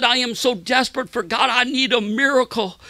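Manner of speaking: high-pitched desperate plea; retold anguish. Sick man needing a miracle, retold anguish. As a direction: plead as the man who needs help. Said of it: "he said it with great, like, anguish".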